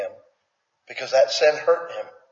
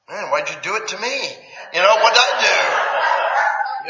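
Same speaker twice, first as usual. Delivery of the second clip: whiny complaint; 5 semitones higher than usual. Retold or imitated. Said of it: "whining complaint imitated".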